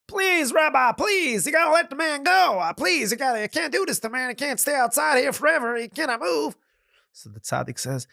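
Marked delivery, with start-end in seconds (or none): 0.1-6.6 high, pleading, much higher voice; begging family. Acted. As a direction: plead with the rabbi to free him. Family begs the rabbi.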